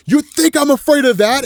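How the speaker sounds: high-pitched, forceful challenge; heightened acted defiance. Johnny facing the imminent end, heightened defiance.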